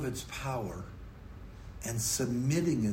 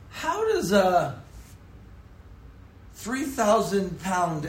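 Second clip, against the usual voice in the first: loud, rising sermon build, higher and louder; preaching at full force; 5 semitones higher, 5 dB louder than usual. Acted. Pastor to congregation, building loud preaching. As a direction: preach it out to the back pews.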